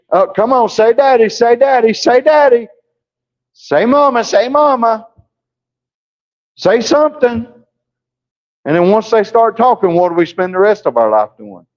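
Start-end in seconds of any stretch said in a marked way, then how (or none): 0.1-7.5 put-on high, sing-song coaxing of a baby; coaxing baby talk. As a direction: coax a toddler to say its first word.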